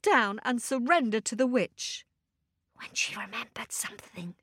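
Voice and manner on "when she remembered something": soft voiceless whisper. A narrator's reading. Storyteller whispering the moment Natasha remembers. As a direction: hush it down to a whisper.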